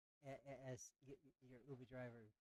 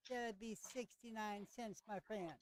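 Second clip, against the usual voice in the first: slow, lightly sung Jamaican vendor voice; bad Jamaican accent; 9 semitones higher, 7 dB louder, slower than usual. Vendor naming the price to the player, slow mock Jamaican accent. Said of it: "it's a horrible Jamaican accent".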